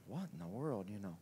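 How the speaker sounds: quietly amazed; hushed bewilderment. Retold or imitated. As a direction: mutter it in disbelief. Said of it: "And he's like".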